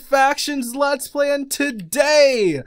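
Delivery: loud, high, drawn-out video opener; hyped intro. Real, not acted. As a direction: belt out a show welcome, stretching the key words. Host's show-opening greeting.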